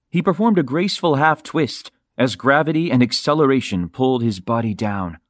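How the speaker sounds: plain reading voice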